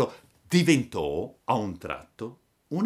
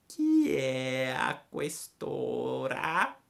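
lenta e acuta, come una lumaca assonnata; voce di lumaca; 5 semitones higher, much slower than usual. A narrator's reading. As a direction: trascina le parole piano. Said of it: "la quale disse". (la lumaca chiede dalla finestra, lentissima)

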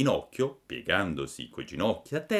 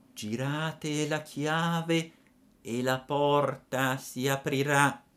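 tiny voice; 6 semitones higher, slower than usual. A narrator's reading. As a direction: speak slow and tiny from inside. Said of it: "disse la solita vocina".

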